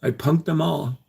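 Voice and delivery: ordinary talk, nothing marked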